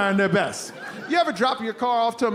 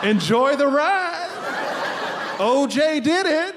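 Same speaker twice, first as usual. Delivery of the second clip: motivational poster read; 5 semitones higher, slower than usual. Retold or imitated. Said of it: "motivational quotes".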